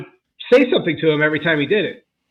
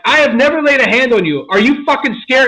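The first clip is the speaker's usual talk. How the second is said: much higher, louder shouting in his face; retold furious yell; 9 semitones higher, 5 dB louder than usual. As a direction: bellow it right in the kid's face. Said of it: "And I was yelling".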